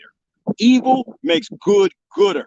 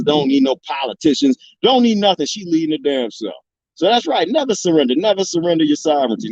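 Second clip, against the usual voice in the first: normal talking voice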